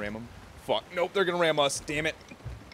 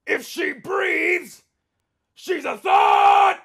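slow shout; 11 semitones higher, 7 dB louder, much slower than usual. Real, not acted. Yelling at game enemy.

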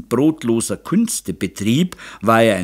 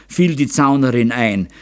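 ordinary delivery, nothing marked

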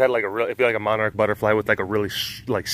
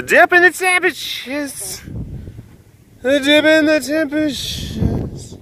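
high, drawn-out hammed-up weather report; exaggerated goofy delivery, pitched way up; silly high voice; 14 semitones higher than usual. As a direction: stretch it out in a high comic voice.

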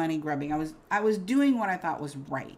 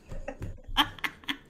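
real airy giggle; an unvoiced laugh at herself; breathy laugh. Real, not acted. Amused by her hidden money, an airy giggle.

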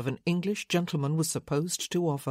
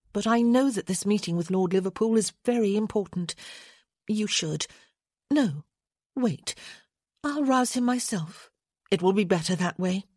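lightly voiced lady, raised pitch; decisive lady; 3 semitones higher than usual. A narrator's reading. Lady deciding to rouse her husband herself, briskly resolved. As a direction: think it over, then decide, in a higher voice.

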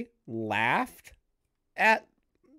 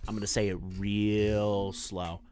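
low, deliberate and slow; real slow; 8 semitones lower, 4 dB quieter than usual. Real, not acted. Debater warning listeners of a harsh verdict, slow and low. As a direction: spell it out slowly in a low voice.

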